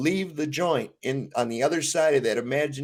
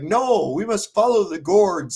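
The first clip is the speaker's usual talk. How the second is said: higher, slow, insistent mock zeal; rival follower; 6 semitones higher, 4 dB louder than usual. Rival sect insisting on the gourd, slow and higher. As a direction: proclaim the gourd with earnest conviction. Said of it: "the other half are like".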